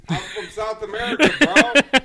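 high, very loud plea; retold loud protest. Bartender telling customer where he's from, retold loud protest. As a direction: re-enact protesting to an angry guy.

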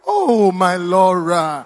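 loud, slow wail falling in pitch; mock lament. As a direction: moan it out theatrically. Acted lament for lost love.

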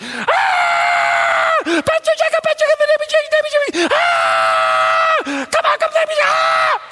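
frantic, very high-pitched shrieking down the phone; imitated screaming. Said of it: "finally got the screaming down".

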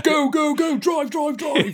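high-pitched getaway yell; mock panicked urging. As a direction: play at frantically ordering the driver off. Acts out a getaway driver.

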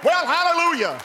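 high, loud praise cry; preacher rejoicing; exultant hallelujah. Real, not acted. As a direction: shout your praise. Preacher exclaims after pause.

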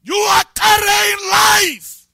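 a high, loud, slow cry of joy; retold revelation shout. Preacher reliving his morning revelation for the church, shouting. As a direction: belt it out like the revelation just hit. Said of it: "I screamed, I shouted".

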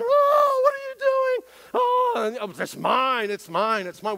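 put-on whiny toddler wail. Toddler wailing at the other child over a toy. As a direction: wail like a two-year-old robbed of a toy.

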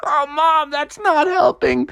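high, complaining cartoon kid; high-pitched protest; whining child.

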